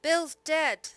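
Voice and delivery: high, loud call from inside. A narrator's reading. Pirate calls the news from the house, high loud call. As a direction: shout the news to the others. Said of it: "One called out".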